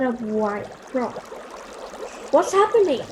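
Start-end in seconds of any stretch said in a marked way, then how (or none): 2.3-3.0 high, loud panicked cry; read-aloud alarmed scream. Acted. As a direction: shriek it in alarm at the table. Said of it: "screamed Auntie Jean".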